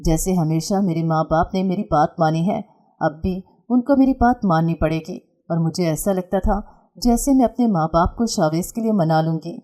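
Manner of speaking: ordinary delivery, nothing marked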